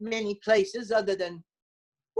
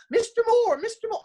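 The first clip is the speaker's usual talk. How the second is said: much higher, eager mom voice; excited mother; 14 semitones higher, 4 dB louder than usual. Retold or imitated. Mother announcing the coach's call, high and thrilled. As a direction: squeal the name like a thrilled mom. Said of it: "my mother was, uh, all excited".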